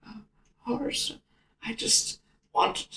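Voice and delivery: softly voiced dying friend; faint dying voice. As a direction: fade out like a man with his last breath. Dying Temba's weak speech.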